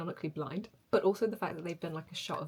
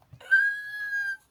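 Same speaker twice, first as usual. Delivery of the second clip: sharp high shocked gasp; big high gasp; loud gasp; 6 dB louder than usual. Real, not acted. Shocked reaction to the scene.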